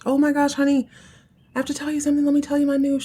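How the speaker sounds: high, bubbling excitement; excited girl. Retold or imitated. Young wife bursting to tell her husband her news, high and bubbly.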